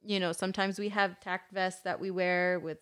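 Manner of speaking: ordinary talk, nothing marked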